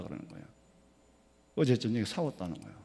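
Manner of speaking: ordinary talk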